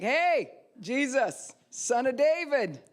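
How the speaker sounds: high, loud call to Jesus; crying out boldly. Shown as a demonstration. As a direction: cry out despite the crowd. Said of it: "I'm gonna be like".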